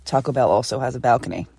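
usual speaking voice

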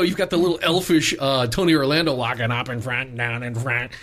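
normal talking voice

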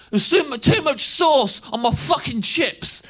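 imitating a rowdy teenager; mock lad complaint. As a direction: grumble loudly like a yob. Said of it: "he went".

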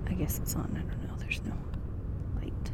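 hushed unsure whisper. Acted. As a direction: whisper to yourself in the dark. Checking recorder in the dark.

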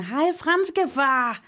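high, drawn-out passing call; retold shout. Podcaster retelling hurrying past the French dad, called-out greeting. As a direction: re-enact hollering a hello in passing. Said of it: "så råbte jeg sådan".

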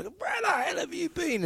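high, exasperated telling-off; exasperated scold. Retold or imitated. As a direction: scold the dog that finally wandered back. Owner scolds returning dog.

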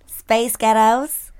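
slow American accent, Space Ghettos drawn out; repeating it in an American accent. Shown as a demonstration. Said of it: "Do it again".

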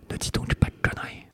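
discreet whisper in the ear. A narrator's reading. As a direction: whisper it close and secret. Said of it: "il me répondit tout aussi discrètement".

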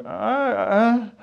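high, soft, drawn-out "ahh"; mock dismayed "uhh". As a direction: whimper a hesitant, let-down "ahh". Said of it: "if you did this and went".

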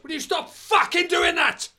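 hammed-up furious cinemagoer losing it; furious outburst. Man in the cinema snaps at the girl behind, hammed-up furious yell. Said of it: "just turns round and goes".

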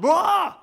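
sudden high loud "wah" cry; wild yell. Retold or imitated. Dying friend suddenly cries out, retold as a high yell. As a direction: let out a startling yell. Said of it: "He said".

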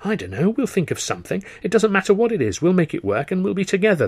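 high, pleading, voice rising; desperate pleading. Acted.

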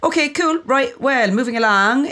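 chirpy presenter moving the show along; upbeat host segue. Real, not acted. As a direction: snap into the next segment like a host. Host switches to next segment.

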